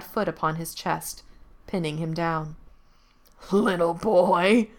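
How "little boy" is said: slow, loud, gloating taunt; mocking demon. A narrator's reading. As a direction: savor each word like a predator. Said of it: "laughed the demon".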